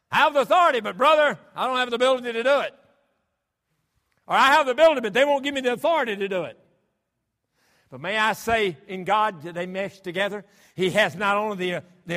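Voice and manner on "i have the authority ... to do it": much higher and louder, pounding each phrase; full-force preaching. Acted. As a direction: hammer the point home from the pulpit.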